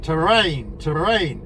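flat robot voice imitation; monotone cockpit warning robot voice. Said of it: "in the robot voice".

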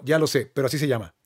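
normal talking voice

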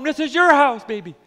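"This is your house" loud and high with passion; fervent father. Acted. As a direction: proclaim "This is your house" at full voice. Father welcoming son home.

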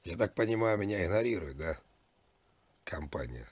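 ordinary talk, nothing marked

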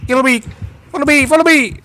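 high sing-song taunt; taunting call. Acted. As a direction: lure him with a high teasing call. Taunting the killer to chase.

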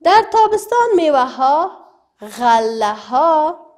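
loud, clear reading from the book to the class. Shown as a demonstration. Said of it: "در کتاب هم گفته". (خواندن بلند و رسای متن کتاب برای صنف)

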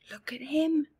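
ordinary delivery, nothing marked

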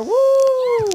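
long, high, loud woo; high-pitched celebratory whoop; cheering woo. Pretend. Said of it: "Let's cheer".

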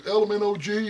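lightly voiced know-it-all rattling off a recipe; imitating Buzzsaw. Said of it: "he was like".

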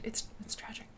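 hushed unvoiced whisper; whispered sorrow. Real, not acted. Host telling her chat about the Brontes' tragic end, whispered. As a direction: let it fall to a whisper.

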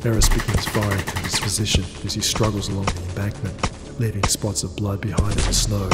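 ordinary delivery, nothing marked

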